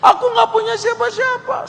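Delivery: put-on high falsetto of a lonely young woman; crying woman's voice. Woman telling the fighter she is alone, high falsetto. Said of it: "Wanita ini bilang".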